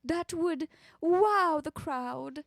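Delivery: put-on old Hollywood transatlantic accent, pitched high. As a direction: keep up the Audrey Hepburn transatlantic accent. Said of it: "Pluvia suggested a transatlantic accent".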